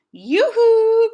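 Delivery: high, loud yoo-hoo call; Mrs. Rogers calling from the kitchen window, loud and high. Acted. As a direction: sing it out across the yard.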